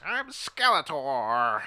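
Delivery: slow, drawn-out cartoon villain voice; hammed-up villain announcing himself, very slow; Skeletor. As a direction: stretch it out like a theatrical villain. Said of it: "I want Skeletor to have that voice".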